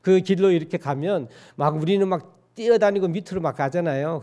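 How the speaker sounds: usual speaking voice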